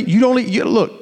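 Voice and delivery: usual speaking voice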